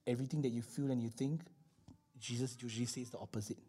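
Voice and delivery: low, soft, confiding delivery; soft and low, almost to yourself; hushed point. Real, not acted. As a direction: drop right down to make the point quietly. Preacher drops low for the point.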